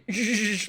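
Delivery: sharp hissed breath imitating punches; boxer's punch exhale. Shown as a demonstration. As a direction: hiss air out like a boxer punching. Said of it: "always never say".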